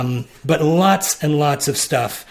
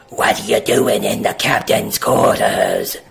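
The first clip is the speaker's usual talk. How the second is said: lower, gruff-sounding creature challenge; horned creature; 6 semitones lower than usual. Acted. Horned creature challenging the party, low and menacing. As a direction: demand an answer like a suspicious brute.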